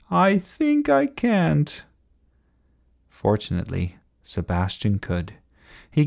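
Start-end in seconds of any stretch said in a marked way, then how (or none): 0.1-1.8 high, slow chanted refusal; reluctant little engine. A narrator's reading. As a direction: drag it out high like a weary chant. Winthrop repeats the chanted refusal.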